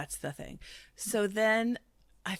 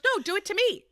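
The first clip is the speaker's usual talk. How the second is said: much higher and louder pleading dare; high goading plea; 13 semitones higher, 6 dB louder than usual. Retold or imitated. Drunk Brandy begging Vanderpump to do it again, high and giddy. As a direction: egg her on, giddy. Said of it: "Brandy goes".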